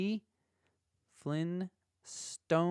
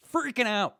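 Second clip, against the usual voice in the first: freaking out; 10 semitones higher, 5 dB louder than usual. Real, not acted. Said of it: "like a maniac".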